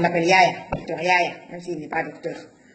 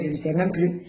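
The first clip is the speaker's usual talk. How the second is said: usual speaking voice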